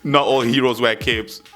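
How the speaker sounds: normal talking voice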